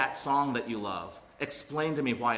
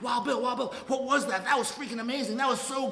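high, breathless rush of delight; giddy excitement; 12 semitones higher than usual. Retold or imitated. Newly sober man telling his sponsor, giddy and high. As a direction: gush like you just had the best night ever. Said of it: "I go to my sponsor, Wild Bill, and I'm like".

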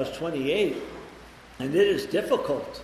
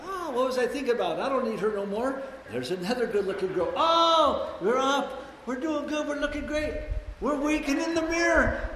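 mock euphoria; 10 semitones higher than usual. Newcomer high on the upswing.

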